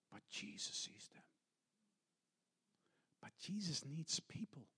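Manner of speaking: whispered, intimate; soft whisper. Acted. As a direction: lean in and whisper it to the congregation. Intimate sermon close.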